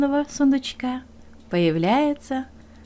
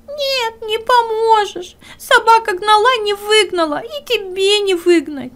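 high, louder crying hare; tearful hare; 11 semitones higher, 6 dB louder than usual. Acted. Hare tells the bear he can't help, tearful and high. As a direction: sob out that nobody can help.

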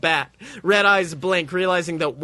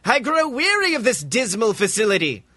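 higher imperious alien whine; haughty alien; 8 semitones higher than usual. A narrator's reading. Zim complaining to Walt, higher imperious voice. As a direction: sneer at the lab like a tyrant.

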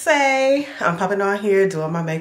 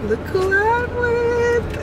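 excited; 4 dB louder, much slower than usual. Real, not acted. Showing off brother in car.

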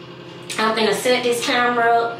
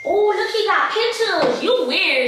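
much higher, laughing taunting accusation; hammed-up outrage, cracking up; fake furious; 10 semitones higher, slower than usual. Staged prank accusation.

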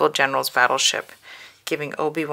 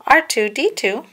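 1 droid name; 9 semitones higher, 5 dB louder than usual. A narrator's reading.

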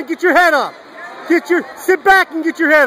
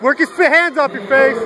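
usual speaking voice

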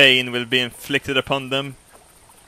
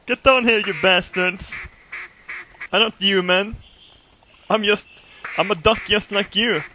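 raised, higher-pitched call to the sky; mock pleading holler; 7 semitones higher than usual. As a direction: holler up, pretending to be one of them. Yelling up at the passing ducks.

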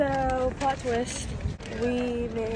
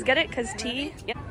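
usual speaking voice

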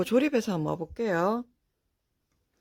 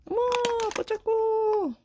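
귀여운 감탄; 14 semitones higher, slower than usual. Real, not acted. 귀여운 장난감 보고 감탄.

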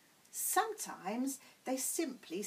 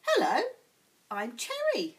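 louder, calling down from above; young giraffe calling down cheerfully; a loud friendly giraffe greeting; bright young giraffe; 4 semitones higher, 8 dB louder than usual. Acted. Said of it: "said a voice above".

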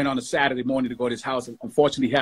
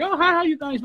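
imitating woman greeting; 14 semitones higher than usual. Singer Wilma greets him, voiced.

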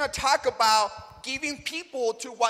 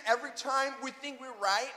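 ordinary delivery

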